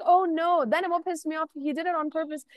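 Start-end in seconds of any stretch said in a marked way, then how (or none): 0.0-0.6 higher, louder hammed-up "Oh, no."; imitating the guy's fake alarm; mock panic from the guy; mock dismay. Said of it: "And he was like".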